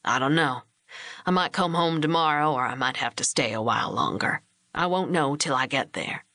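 lightly voiced steady man, reasonable; husband Hugh J. A narrator's reading.

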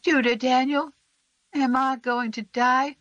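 put-on a sick little boy asking if he'll die; small boy, scared and pleading; Sam. A narrator's reading.

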